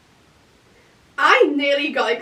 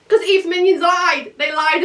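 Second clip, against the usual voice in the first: indignant outburst; 5 semitones higher, 4 dB louder than usual. Real, not acted. Outraged at lying classmates.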